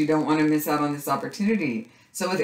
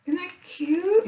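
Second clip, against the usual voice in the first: much higher excited repeat of the line; high delighted squeal. Real, not acted. Crafter squealing to viewers over a little mark maker, high delighted squeal. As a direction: squeal it the second time round.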